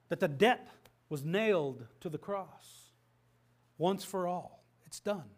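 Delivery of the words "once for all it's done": normal talking voice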